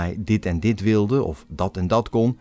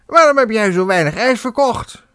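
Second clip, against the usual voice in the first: high, loud manager voice; a pompous director demanding answers; boss voice; 14 semitones higher, 7 dB louder than usual. A narrator's reading. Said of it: "riep de dikke deur". (directeur eist hoog en luid uitleg)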